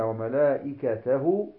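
وملائكته ending in a long -hoo; linked ha with damma. Shown as a demonstration. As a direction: say وملائكته as wa-malaa'ikatahoo, madd held. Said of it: "هاء مضمومة".

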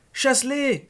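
put-on high, loud yell of a frightened child; loud, high-pitched cry for help; frantic yell. A narrator's reading. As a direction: yell it out in panic. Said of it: "hurla Danny".

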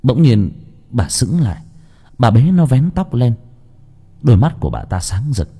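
ordinary delivery

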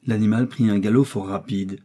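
ordinary delivery, nothing marked

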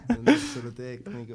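said through a real laugh; amused half-laughed remark. Real, not acted. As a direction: laugh the words out. Laughing during banter.

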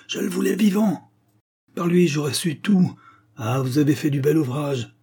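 high-pitched exasperated outburst; cursing. A narrator's reading. Inspector robbed of his live prisoner, exasperated. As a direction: vent it, starting high and easing down. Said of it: "L'inspecteur poussa un juron".

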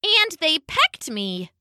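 high, slow small voice; little penguin. A narrator's reading. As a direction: pipe it slowly like a hurt chick. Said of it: "said a little penguin".

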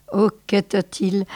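usual speaking voice

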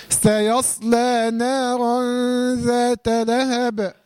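ذات لهب slow, with a bounced qalqala on the ب; slow recitation demo. Shown as a demonstration. Teacher models the verse slowly for the student. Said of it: "تنساش قلقلة ايه؟".

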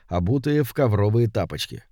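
ordinary delivery

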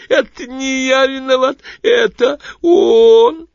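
high, loud, crying whine; whining tearful creature. A narrator's reading. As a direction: wail it in tearful terror. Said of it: "скрежещущий звук, который произнесло скулящее нечто". (скулящий плачущий визг)

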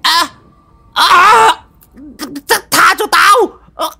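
high, loud yelps, stammering; yelping in pain. Acted. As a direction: howl in pain, near tears. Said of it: "Lão Mo rú lên oai oái".

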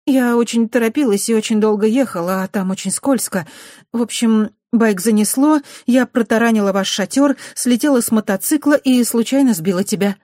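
put-on high, loud hurried girl's explanation; girl excusing herself. A narrator's reading. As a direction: rush through the excuse. Dukati explaining in a rush. (торопливое оправдание)

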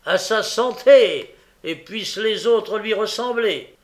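high, very loud cheer; rousing toast. A narrator's reading. Sikes toasts Nancy to the gang, loud and rousing. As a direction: raise a booming toast.

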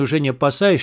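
usual speaking voice

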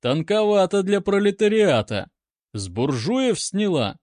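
mocking jibe, starting high and loud; sarcastic. A narrator's reading. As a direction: taunt with acted sarcasm. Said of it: "съязвил поручик".

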